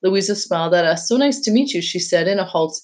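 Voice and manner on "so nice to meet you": plain reading voice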